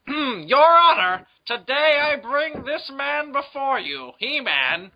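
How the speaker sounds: high, slow, booming lawyer voice; pompous courtroom orator. Acted. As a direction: open the case with grand pomp. Skeletor prosecuting in court.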